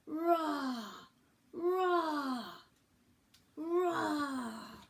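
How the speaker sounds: loud playful roar, rising to a shout; a hammed-up dinosaur call from the jungle. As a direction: roar like a big friendly dinosaur. Dinosaur family roaring.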